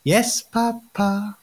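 put-on slow, high eerie kid singsong; creepy child voice. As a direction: sing it like a creepy little kid. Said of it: "in these creepy little kid voices".

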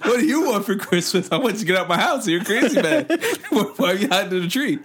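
high-pitched Santa, laughing as he yells; a freaked-out Santa chasing you off; startled Santa. Acted. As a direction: Santa catches someone hugging his tree. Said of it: "Santa comes out like".

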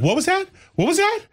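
high rising repeated question, alarmed; alarmed mother. Retold or imitated. Mother overhearing the joke, high alarmed questions. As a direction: shoot it up high in disbelief. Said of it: "Her mother was like".

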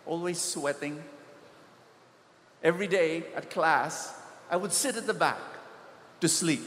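normal talking voice

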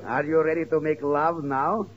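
usual speaking voice

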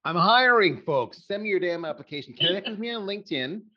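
loud opener, pitched high, like a call-out; punchy hiring pitch. Real, not acted. Host pitches listeners to apply for jobs, loud and punchy. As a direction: call out to every job seeker listening.

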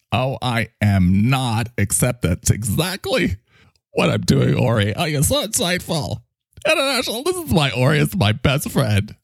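indignant turning to gushing, in character; excitable sidekick with swooping pitch; fawning microphone character. Acted. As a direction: swing from denial into admiration. Mike flatters Ori after denying.

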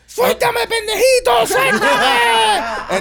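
high, hammed-up furious grandma shout; acted angry yelling. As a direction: yell to be let go, mid-fight. Acting grandma fighting off his grip. (abuela furiosa gritando, imitada a lo loco)

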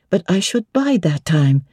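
plain reading voice

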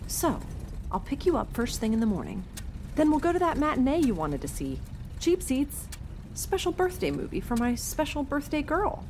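lilting, upbeat mom voice; cheery mom. A narrator's reading. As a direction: pitch the birthday treat to your kid. Mother pitching birthday plan to daughter.

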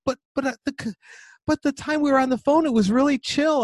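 high, loud mock son protesting; whiny son. Son defends his casual email to dad, high mock whine. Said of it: "He goes".

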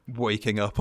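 ordinary talk

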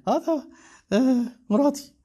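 high-pitched, laughing words; amused. Real, not acted. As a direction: talk through a chuckle, pitched well up. Laughing about his wife listening.

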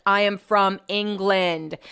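slow, word-by-word correction; emphatic correction. Retold or imitated. Brett correcting the drunk neighbor, slowly emphatic. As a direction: spell it out slowly for him.

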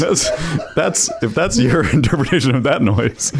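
laughing through the words; barely holding back laughter. Real, not acted. Laughing at the misheard remark.

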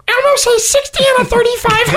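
very high squeaky Elmo to a cop. Pretend. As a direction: answer the officer as Elmo. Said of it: "bust out Elmo on a traffic stop".

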